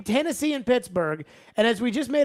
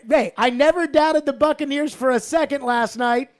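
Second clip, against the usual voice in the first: loud, higher-pitched mock confidence; facetious; 5 semitones higher, 6 dB louder than usual. Real, not acted. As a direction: boast loudly, tongue in cheek. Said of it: "I say that facetiously".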